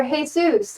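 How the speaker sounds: Jesus said hay-SOOS, with an H; Spanish pronunciation. Shown as a demonstration. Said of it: "Uh, the Spanish name".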